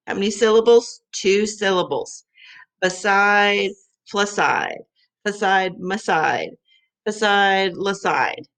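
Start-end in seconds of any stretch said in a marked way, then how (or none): 2.8-8.4 "Beside" then the made-up rhyme, first sound swapped each time; rhyming demo. Shown as a demonstration. Teacher showing rhymes of beside to students, sounding out each one. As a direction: show how changing the first sound of "Beside" makes "fleside", "maside", "laside". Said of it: "Just changing the beginning".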